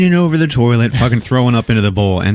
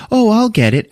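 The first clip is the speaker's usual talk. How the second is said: eager offer; 13 semitones higher than usual. Retold or imitated. Guest rushes to help.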